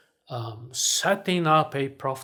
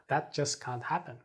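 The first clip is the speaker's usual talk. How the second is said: ordinary talk, nothing marked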